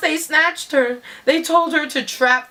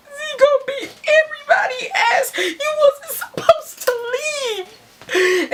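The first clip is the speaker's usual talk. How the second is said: very high, shrieked tirade; hysterical scolding. Retold or imitated. Storyteller tells off the driver for leaving, hysterical. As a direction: shriek it at the one who left you.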